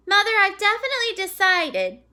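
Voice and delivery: a hint of a child's firm announcement; declaring girl. A narrator's reading. Daughter telling mother she's staying home, firm declaration.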